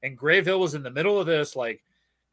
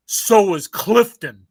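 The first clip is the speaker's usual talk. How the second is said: slow, loud tough-guy drawl; higher, slow swaggering mock thug; mock tough Jersey guy; 3 semitones higher, 8 dB louder, much slower than usual. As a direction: ham up a Jersey hard case, slow and loud. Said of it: "from Boston motherfuckers going".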